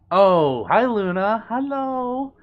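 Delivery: high, loud, happy hello; light up on recognising a friend, voice leaping up; squealy-sweet excited hello; delighted greeting. Real, not acted.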